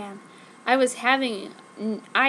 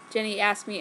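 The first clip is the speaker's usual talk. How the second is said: ordinary delivery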